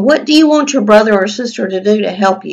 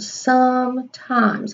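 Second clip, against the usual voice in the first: drawn-out dictation; 4 semitones higher, 5 dB quieter, much slower than usual. Shown as a demonstration. Teacher to class, slowly dictating sometimes. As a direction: stretch out sometimes slowly as she writes it. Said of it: "that's a big word".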